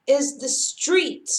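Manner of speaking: ordinary delivery, nothing marked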